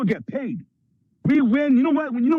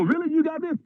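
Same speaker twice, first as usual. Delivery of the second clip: surprised; 6 semitones higher than usual. Retold or imitated. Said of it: "And you go".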